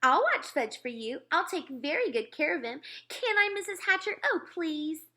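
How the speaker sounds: put-on high, pleading little-girl voice; eager begging. A narrator's reading. Sheila begging to watch Fudge, high eager pleading. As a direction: beg to be allowed, pitch climbing. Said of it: "begged some more".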